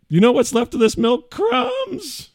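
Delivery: high mock yell ending on crumbs; mocking wife. Father and son mocking wife over milk, loud. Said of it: "like, the milk".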